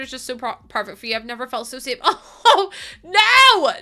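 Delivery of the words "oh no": high, loud laughing shriek; laughing cry. Real, not acted. Streamer overwhelmed by chat's kindness, laughing. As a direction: squeal it out, overwhelmed.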